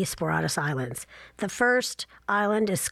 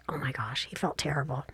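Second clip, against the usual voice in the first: whisper; 5 semitones lower, 7 dB quieter than usual. Real, not acted. Hushed aside about husband.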